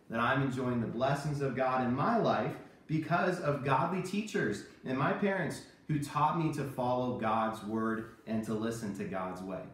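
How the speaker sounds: ordinary talk, nothing marked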